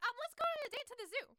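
high, loud, rushed blurt; embarrassed. Retold or imitated. Embarrassed heroine suggesting a zoo date, blurting. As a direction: blurt it fast to cover the embarrassment. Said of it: "she's embarrassed".

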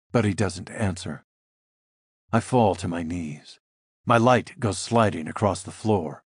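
ordinary delivery, nothing marked

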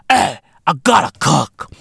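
ordinary delivery

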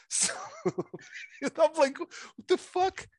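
high-pitched, laughing incredulous outburst; laughing disbelief. Retold or imitated. Host retells spotting the accent change, laughing in disbelief. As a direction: crack up while retelling your bafflement.

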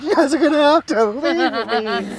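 high, loud mock wail; hammed-up desperate howl; yelling in pain. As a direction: play the wounded friend crying out. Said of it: "and he's yelling".

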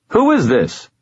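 high, impatient demand; suppressed temper. A narrator's reading. Annoyed man to unknown caller, impatient demand. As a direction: demand it while holding back anger. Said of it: "choked down a vicious response".

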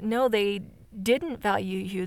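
ordinary talk

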